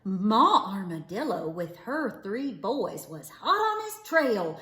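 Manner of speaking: usual speaking voice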